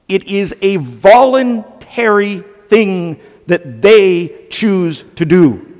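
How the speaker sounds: slow, weighty, each word laid down; slow right down to drive it home; slow emphasis. Real, not acted. Preacher explaining the word submit to listeners, slow and weighty.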